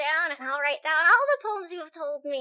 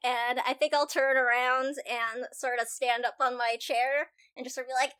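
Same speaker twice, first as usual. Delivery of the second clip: ordinary talk, nothing marked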